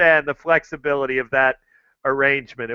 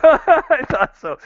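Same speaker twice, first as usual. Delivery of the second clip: laughing through the words; 10 semitones higher, 5 dB louder, slower than usual. Real, not acted. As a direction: repeat it while cracking up. Repeats it laughing.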